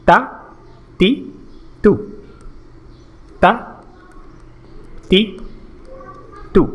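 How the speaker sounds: তা, তি, তু as separate short syllables; short vowel drill. Shown as a demonstration. Teacher models the letter with three marks, তা, তি, তু each brief. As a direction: cut the vowels short in তা, তি, তু, a pause after each. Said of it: "খুব দ্রুত করতে হবে".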